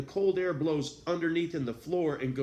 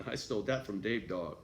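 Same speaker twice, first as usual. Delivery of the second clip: ordinary talk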